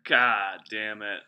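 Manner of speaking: slow, loud, stretched-out curse; drawn-out loud curse. Real, not acted. Reacting to a creepy story with a drawn-out loud curse. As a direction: let it out long and loud in disbelief.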